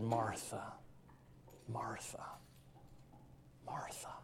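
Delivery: soft, tender, fading to a whisper; compassionate. Retold or imitated. Jesus gently calling Martha by name, tender and soft. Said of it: "in a very compassionate tone".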